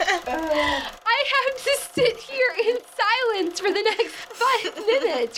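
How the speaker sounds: high, slow laughing whine. Real, not acted. Mock suffering.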